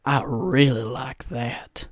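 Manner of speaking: slow, drawn-out delivery; put-on catchphrase. Shown as a demonstration. Comic says it to make girlfriend laugh, slow put-on delivery. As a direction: stretch each word out for a laugh. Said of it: "I'll say this. I'll go".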